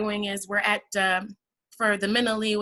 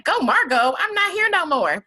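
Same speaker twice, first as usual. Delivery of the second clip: Margot joking; 9 semitones higher, 8 dB louder than usual. Retold or imitated. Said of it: "She's like".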